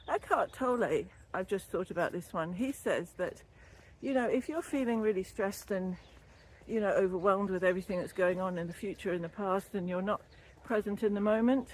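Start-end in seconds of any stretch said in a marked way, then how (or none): none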